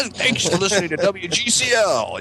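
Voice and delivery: high, slow mock DJ voice; radio announcer voice. Friends retelling old tapes, in a high, slow mock announcer voice. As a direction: ham it up like a radio jingle.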